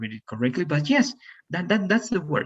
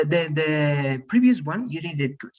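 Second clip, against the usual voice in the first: usual speaking voice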